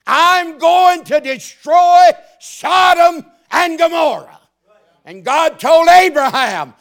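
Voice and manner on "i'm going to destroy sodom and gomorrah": God's shouted decree. Acted. God voiced declaring destruction.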